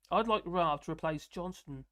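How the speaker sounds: ordinary delivery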